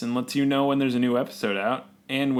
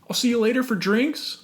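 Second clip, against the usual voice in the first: breezy coworker voice; 11 semitones higher than usual. Pretend. Role-playing coworker friends.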